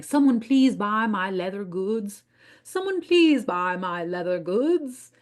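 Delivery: drawn-out, Southern-tinged mock plea; mock pleading. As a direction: plead like a needy peddler with a hint of Southern. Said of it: "trying my very best to not do my Southern accent".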